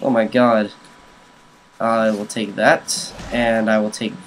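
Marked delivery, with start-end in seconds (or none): none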